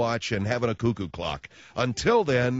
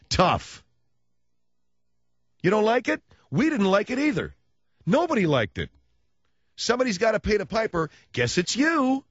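taunting rant; 8 semitones higher, 3 dB louder than usual. Real, not acted. Ranting at Germans, taunting.

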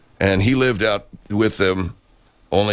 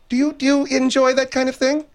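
mock high voice.

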